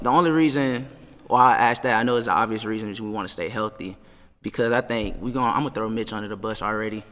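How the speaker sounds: ordinary delivery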